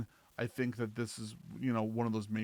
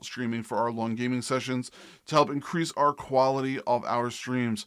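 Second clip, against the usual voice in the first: loud, punchy sponsor plug; sponsor read; 9 dB louder than usual. Acted. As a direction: read it like a louder ad spot.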